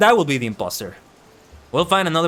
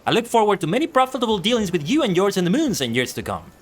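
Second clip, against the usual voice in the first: blustering merchant; 5 semitones higher than usual. Acted. Streamer voicing Yalto Nolto bragging to the guard, higher and hearty. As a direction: puff up like a boastful trader.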